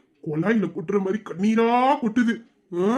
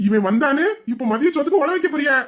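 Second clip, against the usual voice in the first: indignant outburst; 6 semitones higher than usual. Acted. Son indignant at mother.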